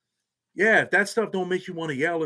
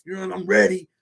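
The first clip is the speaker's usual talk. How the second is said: higher-pitched imitation of cocky boasting; talking big; 3 semitones higher than usual. Kid bragging to his man down there, mock swagger. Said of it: "he's down there talking big".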